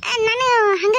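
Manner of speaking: tearful, breaking voice; a hint of a sob; crying. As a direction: speak through tears. Weeping woman answers.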